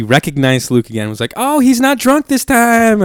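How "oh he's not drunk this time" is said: high, slow, cartoonish guard voice; guard impression. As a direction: pitch it way up and drag it out. Security guard quoted.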